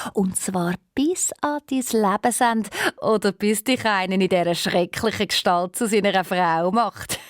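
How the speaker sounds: plain reading voice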